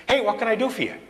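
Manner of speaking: informal, friendly-request Jesus; conversational Jesus. Acted. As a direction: toss it out like chatting to a passer-by. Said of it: "it's more conversational than that".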